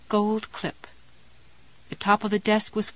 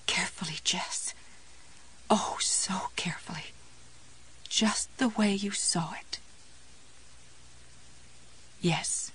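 soft, breath-held whisper; hushed self-coaxing; 10 dB quieter than usual. A narrator's reading. Jessie steadying herself over the jar, whispered. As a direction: coax yourself along very gently, whispering.

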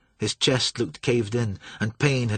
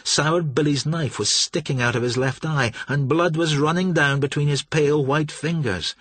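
plain reading voice

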